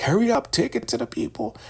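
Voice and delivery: giddy elder woman. Retold or imitated. Elder woman gleefully announcing the Raku to everyone, high giddy voice. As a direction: chirp it like a thrilled believer.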